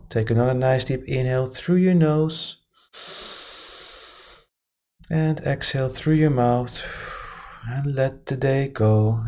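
calm, unhurried meditation guide; guided meditation. Acted. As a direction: ease them gently into relaxation.

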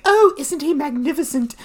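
high, gushing lady voice; admiring society lady. Acted. Party lady admiring the guest, high and gushing. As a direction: swoon in a high-pitched voice. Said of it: "this other lady's like".

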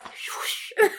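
whispered shoosh. Pretend. Repeating the funny word.

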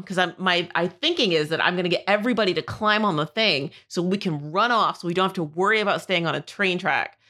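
normal talking voice

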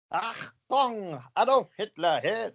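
high mock German villain; movie villain German accent. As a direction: bark it like a cartoon Nazi. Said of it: "That's not Adolf Hitler's voice".

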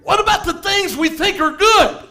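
sudden high preacher's yell; preacher yells out the question. Real, not acted. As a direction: shout the question at the crowd. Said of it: "I don't mean to yell at you tonight".

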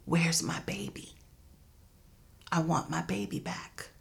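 put-on whispered, broken plea for her baby; a hushed, grieving cry; retold wailing. Mother after surgery, asking for her lost baby, whispering brokenly. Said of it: "my own voice wailing".

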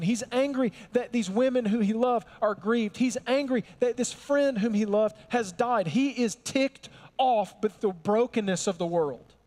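fervent preacher in full flow; impassioned preaching. Real, not acted. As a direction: drive home each repeated phrase with indignation. Preaches with force on anger.

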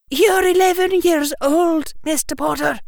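put-on stern old professor, high and loud; harsh whisper. A narrator's reading. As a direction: hiss it like a strict teacher. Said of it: "she said in a harsh whisper".

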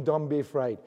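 gently calm the frightened disciples, almost murmuring; quiet reassurance. Pretend. Jesus reassures, voiced.

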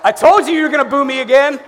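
a real laughing call to the crowd; laughing shout. Real, not acted. Pastor teasing the booing congregation, laughing shout.

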